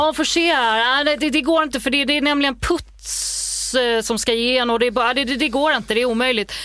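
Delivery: wife imitates husband as a trendy nineties guy; nineties Stockholm guy. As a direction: play the expert who finds excuses. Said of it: "får jag min nittiotalsstock".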